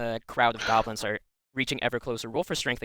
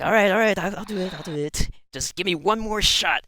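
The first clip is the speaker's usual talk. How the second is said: archer grumbling; 8 semitones higher, 5 dB louder than usual. Acted. GM voices archer to partner.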